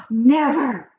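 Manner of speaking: a sharp, loud, high-pitched cry of disbelief; cried out. A narrator's reading. Boy shocked by his grandmother's story, cries out loud and high. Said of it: "I cried".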